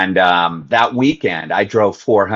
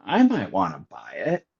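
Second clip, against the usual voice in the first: softer echo, lifting into a question at the end; incredulous echo; 7 dB quieter than usual. Retold or imitated. Echoing his brother's boat suggestion, incredulous. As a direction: repeat it back in disbelief.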